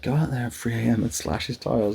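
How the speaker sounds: lightly put-on Irish accent; a hint of rough Irish, matter-of-fact. Mum urging her child to vandalise the van, lightly Irish accent. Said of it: "suddenly become, like, Irish again".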